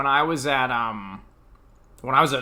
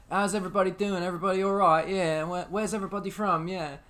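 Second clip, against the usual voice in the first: higher-pitched British tour guide; put-on East London British; British accent; 6 semitones higher than usual. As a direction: greet the tour group in a shaky attempt at British. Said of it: "One time I did a British one".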